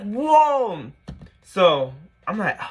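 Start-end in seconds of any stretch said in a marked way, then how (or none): 0.0-0.9 high, drawn-out mock astonishment; stunned whoa. Best friend reacting to the reply, high mock astonishment. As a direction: stretch it out high in amazement.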